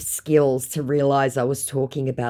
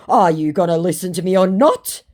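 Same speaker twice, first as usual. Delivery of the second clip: loud, raised mock demand; mock impatient demand; 5 semitones higher, 6 dB louder than usual. Scammer demands to be heard, mock impatient.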